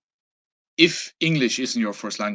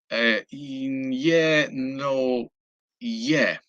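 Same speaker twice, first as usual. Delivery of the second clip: hesitant; 4 semitones higher, much slower than usual. Retold or imitated. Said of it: "hesitant. He wasn't very sure".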